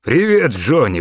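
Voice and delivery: put-on breathless old man bursting in; exclaimed greeting. A narrator's reading. Gramp bursting into the office, calling out loud. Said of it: "выдохнул".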